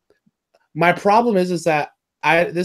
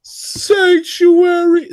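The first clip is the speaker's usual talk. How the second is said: very high, loud, drawn-out mock wail; exaggerated wail; 7 dB louder, much slower than usual. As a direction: stretch the word out in a piercing high cry. Exaggerated repeat of the same word.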